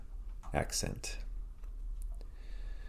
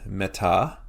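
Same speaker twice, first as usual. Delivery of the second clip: "meta" with the stress on a full final "ta"; last-syllable stress. Shown as a demonstration. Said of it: "Our emphasis is on the last syllable".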